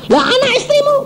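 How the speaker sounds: high, startled question; a touch of disbelief; mock incredulous doctor. Doctor asks the preacher about his family, high and startled.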